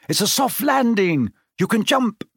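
high-pitched reassuring call. A narrator's reading. Harry urging his friends to jump, calling up. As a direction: pitch it up to carry overhead. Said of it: "he called up to the light".

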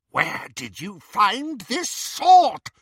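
high, loud, slow demand; insistent demand. Acted. Bellatrix interrogating Greyback, loud slow demand. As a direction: press her question harder. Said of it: "she repeated".

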